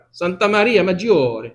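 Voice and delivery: Santa Maria Maggiore said with Italian vowels, madjor; proud little Italian accent on Santa Maria Maggiore. Shown as a demonstration. Preacher showing off his Italian accent on the church name. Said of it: "Mon accent italien, c'est parfait".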